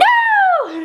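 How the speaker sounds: a sudden high squeal of a laugh; high burst of laughter. Real, not acted. Laughing at the villain flying out of the church, high burst of laughter. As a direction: crack up at the scene. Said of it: "I thought it was hilarious".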